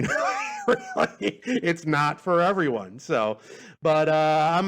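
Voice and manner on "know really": laughs, high and squeaky; laughing squeal. Real, not acted. Podcast host cracking up at his own joke, high and laughing.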